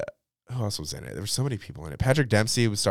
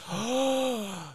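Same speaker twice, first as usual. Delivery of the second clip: high shocked intake of breath; sharp gasp. Real, not acted. Remembering the [name] feud, sharp gasp. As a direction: gasp in alarm.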